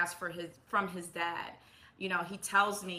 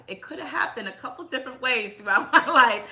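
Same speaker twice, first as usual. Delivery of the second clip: higher, louder, laughing through the words; amused relief, breaking into a laugh; 5 semitones higher, 6 dB louder, faster than usual. Real, not acted. Mother looking back on picking a good dad, laughing. Laughing over her luck.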